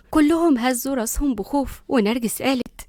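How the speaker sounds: plain reading voice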